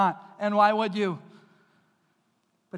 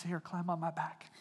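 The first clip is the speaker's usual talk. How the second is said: soft, hushed, reassuring; Jesus quietly inviting the weary to be carried; tender invitation; 3 semitones lower, 8 dB quieter than usual. Retold or imitated. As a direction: coax them gently onto your back. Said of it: "And Jesus says".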